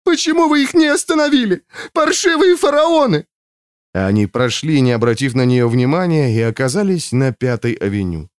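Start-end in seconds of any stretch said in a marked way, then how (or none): 0.0-3.3 very high-pitched wailing woman; sobbing. A narrator's reading. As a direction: wail the accusation tearfully, right at the top of the voice. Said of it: "прорыдала она".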